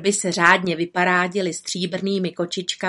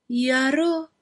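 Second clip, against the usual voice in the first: sad voice; 6 semitones higher than usual. Acted. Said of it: "řekla žena smutným hlasem".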